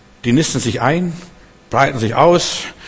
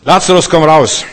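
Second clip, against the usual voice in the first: brisk and louder, "Lazarus" clipped short; short crisp command; 4 semitones higher, 6 dB louder than usual. Shown as a demonstration. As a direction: snap out "Lazarus, komm raus" as a terse order. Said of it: "knapp, es ist kurz, zackig".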